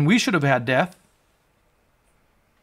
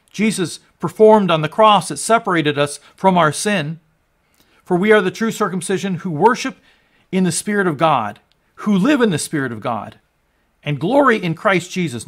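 louder and higher, building to a roaring proclamation; full-force preaching; 4 semitones higher, 6 dB louder than usual. Acted. Preacher to his congregation on the paid debt, building full-force preaching. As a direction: preach it out, swelling with every line.

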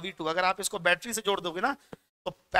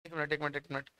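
muttering to himself; 5 semitones lower, 10 dB quieter, faster than usual. Real, not acted. Teacher checking own confusion.

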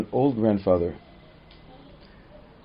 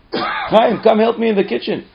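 higher, louder call to a son; mother calling; 10 semitones higher, 5 dB louder than usual. Retold or imitated. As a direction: summon the next kid. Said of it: "So she calls Chaim".

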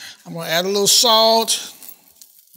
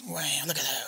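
whispered, much softer; awed whisper; 5 semitones lower, 12 dB quieter than usual. Real, not acted. Cook marveling at the Cajun spice, whispering. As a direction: hush it like a secret treasure.

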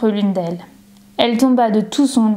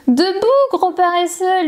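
bright, much higher cheerful wake-up call; joyful; 8 semitones higher than usual. A narrator's reading. Little girl wakes her lazy dog, joyful high teasing. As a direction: tease the sleepy dog awake, happily. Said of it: "lui dit-elle joyeusement".

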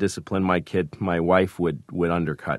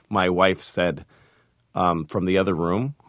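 ordinary talk

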